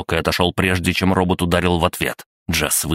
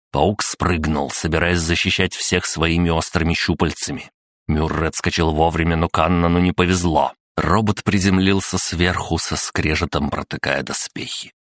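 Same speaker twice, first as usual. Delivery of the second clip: usual speaking voice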